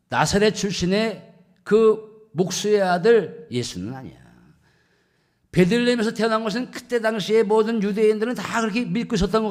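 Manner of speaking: loud, high-pitched sermon delivery; forceful preaching. Real, not acted. Preacher explains Nathanael's fixed belief about Bethlehem, preaching forcefully.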